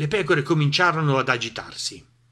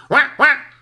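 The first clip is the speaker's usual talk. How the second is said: high, loud quacking duck voice; shouting duck; 12 semitones higher, 7 dB louder than usual. A narrator's reading. Duck warns the animals of the wind, high shouting voice. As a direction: cry it out as a squawky cartoon duck. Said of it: "Papera gridò ancora".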